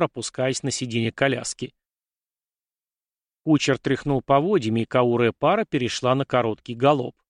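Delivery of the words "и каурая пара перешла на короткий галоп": usual speaking voice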